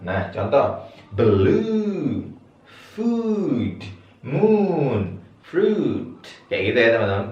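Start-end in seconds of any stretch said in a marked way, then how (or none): 1.1-6.2 "Blue", "Food", "Moon", "Fruit" each with a long oo pitched high. Shown as a demonstration. Teacher modelling high long U words for the class. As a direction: lift every long oo high. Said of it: "I-nya I tinggi".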